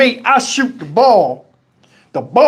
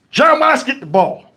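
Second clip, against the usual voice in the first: ordinary talk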